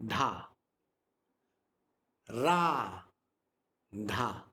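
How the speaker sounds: low short "Dha", long "Ra", short "Dha"; short versus long. Shown as a demonstration. Teacher contrasting syllable durations, Ra long, Dha short. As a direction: hold "Ra" long, keep each "Dha" brief and low. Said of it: "this is how it is".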